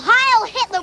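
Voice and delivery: high, jeering imitation of a salute; scornful mock cheer; mock yell. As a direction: mimic a crowd's cry with scorn. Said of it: "throw flowers at him and yell".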